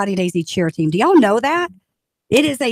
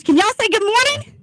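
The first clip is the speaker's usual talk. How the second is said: excited call-out; 10 semitones higher than usual. Real, not acted. Host calls on cheerleaders.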